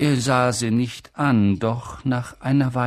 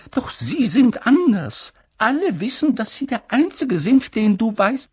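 McGonagall voice; 11 semitones higher, 5 dB louder than usual. Acted. McGonagall admiring.

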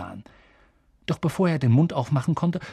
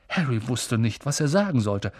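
plain reading voice

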